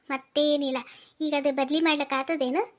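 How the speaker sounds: usual speaking voice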